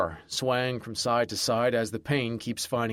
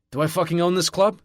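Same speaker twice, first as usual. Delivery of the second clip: higher and louder, barking it out; barked; 6 semitones higher, 5 dB louder than usual. A narrator's reading. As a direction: snap it at him like an order. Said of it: "I bark".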